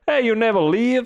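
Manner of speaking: high-pitched mock guest voice; amazed guest. Hotel guest to evening worker next morning, high amazed voice. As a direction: call it out in high, teasing amazement. Acted guest noticing he's always there.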